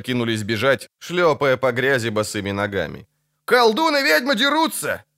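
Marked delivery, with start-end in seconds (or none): 3.4-5.0 high, sustained cry; high-pitched street cry; alarmed shout. Acted. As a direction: call the news out high. Vagrants shouting the news in flight.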